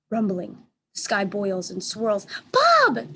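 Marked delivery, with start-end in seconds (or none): 2.5-3.1 very high, loud call. A narrator's reading. Julia calling Bob in the storm, high loud shout. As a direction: shout for the dog across the storm. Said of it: "Julia calls".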